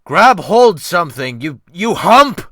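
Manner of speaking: put-on captain bellowing at the boy from the poop; shouted order. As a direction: roar it down from the poop. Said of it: "Wolf Larsen shouted from the poop".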